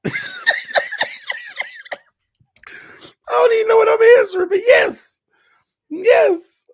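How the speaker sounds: high loud laughing yell; cry-laughing shout; shrieking laugh. Real, not acted. Hysterical laughter at question.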